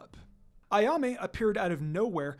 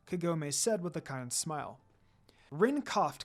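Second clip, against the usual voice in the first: usual speaking voice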